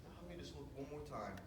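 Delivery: hurried hushed aside; quiet and quick. Retold or imitated. Hunter in the blind, quietly and quickly talking to himself. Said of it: "I said".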